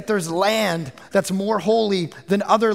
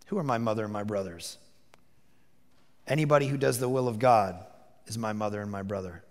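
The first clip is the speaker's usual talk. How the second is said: much lower and softer, unhurried; low, solemn; 11 semitones lower, 7 dB quieter, faster than usual. Acted. Jesus answers the crowd about his family, low and solemn. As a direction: say it quietly with weight.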